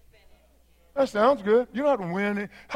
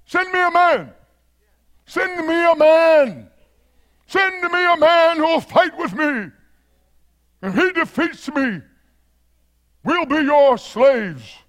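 taunting giant; 5 semitones higher than usual. Acted. Goliath challenges Israel to send a man, taunting call. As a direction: call out the dare like a warrior before an army. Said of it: "He's taunting Israel".

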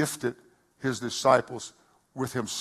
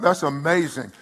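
normal talking voice

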